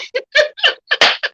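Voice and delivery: laughing. Real, not acted. Laugh mid praise.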